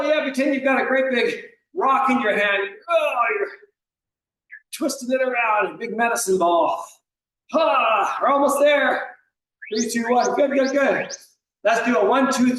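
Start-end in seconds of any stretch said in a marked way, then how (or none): none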